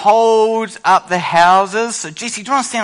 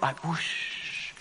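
breathed, voiceless whoosh, much softer; quiet whoosh; 3 semitones lower, 18 dB quieter than usual. Shown as a demonstration. Showing the class how to start the storm, a soft breathy whoosh. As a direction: barely breathe the wind sound. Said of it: "So we can start quiet".

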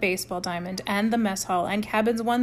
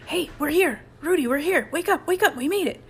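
much higher, louder joyful wake-up call; excited shout; 8 semitones higher, 5 dB louder than usual. A narrator's reading. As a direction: shout it, thrilled to arrive. Mike wakes, shouts joy.

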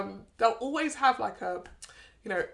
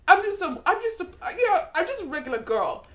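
airhead model voice; 8 semitones higher, 3 dB louder than usual. Retold or imitated. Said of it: "They'll be like".

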